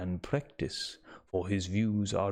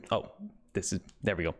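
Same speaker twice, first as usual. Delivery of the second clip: plain reading voice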